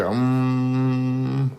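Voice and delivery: sustained droning hum; imitated Om sound. Showing how the thing sounded on the speaker, droning Om. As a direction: hum one long, even note. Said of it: "so this thing sounded".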